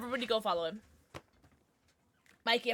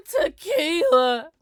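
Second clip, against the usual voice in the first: gasp, then a long high wail; slow, mock-anguished cry; dramatic announcement; 4 semitones higher, 11 dB louder, much slower than usual. Real, not acted. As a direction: draw it out loud and high. Calling out the winning vote.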